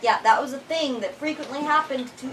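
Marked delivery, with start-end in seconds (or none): none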